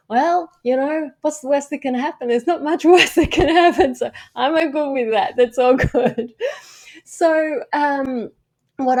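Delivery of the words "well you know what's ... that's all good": higher and louder, laughing through the words; breezy self-talk, laughing. Retold or imitated. Telling herself failure doesn't matter, laughing. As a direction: shrug off the worst with a laugh. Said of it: "'cause I just go".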